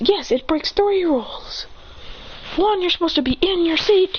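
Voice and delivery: high, insistent rule-quoting; strict scolding. Acted. Rule-keeper scolds classmate.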